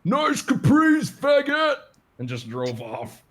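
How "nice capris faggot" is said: high, loud, slow Boston yell; shouted insult. Retold or imitated. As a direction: yell it from a passing car like a Boston guy. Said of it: "yelled from out of their car".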